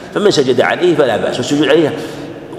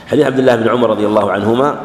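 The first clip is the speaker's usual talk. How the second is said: normal talking voice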